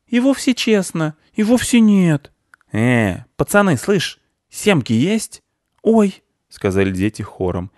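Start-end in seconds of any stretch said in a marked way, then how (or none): 0.1-2.3 high-pitched insistent contradiction; squabbling boys. Acted. Boys argue over fairness, high and insistent. 2.7-6.2 high character voices; cheeky street-kid squirrel, startled children. Acted. Squirrel hails the boys, who gasp, high-voiced.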